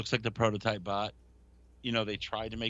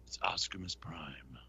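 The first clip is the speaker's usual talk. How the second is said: whisper; 10 semitones lower, 10 dB quieter than usual. Acted. Joking toy name reveal.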